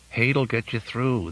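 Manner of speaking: Finn's voice. A narrator's reading. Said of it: "the voice said".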